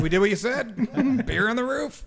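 high-pitched, amused mock quote; laughing imitation. Imitating workers while laughing.

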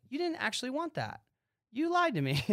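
imitating his disappointed self, high and wounded; bummed accusation. Host retelling letting down a would-be creator, mock hurt. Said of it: "I'm so bummed".

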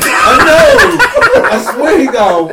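laughing. Real, not acted. Jokes that the host is wired.

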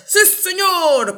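drawn-out high "yes sir"; military platoon reply. A narrator's reading. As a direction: answer like troops to their general. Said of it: "contestan todos".

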